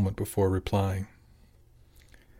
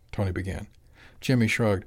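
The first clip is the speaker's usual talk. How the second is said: ordinary delivery, nothing marked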